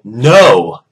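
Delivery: loud, drawn-out refusal; really loud. Retold or imitated. Student answering the coach back, really loud. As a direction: let it burst out of the mumbling. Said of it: "I said that part really loud".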